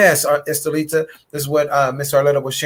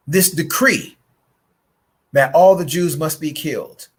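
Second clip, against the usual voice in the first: ordinary talk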